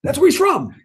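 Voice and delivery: squealing high, eager "oh, oh, oh"; excited eager oh. Retold or imitated. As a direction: bounce up high like a student who knows. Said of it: "And we're all going".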